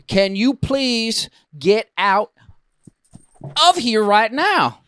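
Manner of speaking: high, slow, drawn-out plea; retold strained request; exasperated demand. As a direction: stretch out each word insistently.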